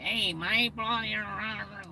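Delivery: mock a broad over-the-top Aussie twang; exaggerated Aussie accent. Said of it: "the accent seems to be super crazy".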